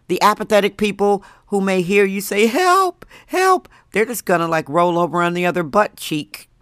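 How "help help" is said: weak cry. Retold or imitated. Mock feeble cry for help.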